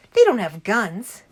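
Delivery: loud, slow protest of disbelief; shocked disbelief. A narrator's reading. Danny protests to his dad about the keepers, shocked disbelief. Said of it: "I gasped".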